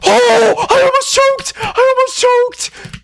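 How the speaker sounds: very high, loud crying scream; screaming with relief. Real, not acted. Gamer barely survives the end, very high crying scream. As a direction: scream your relief, half sobbing. Nearly failed, then cleared.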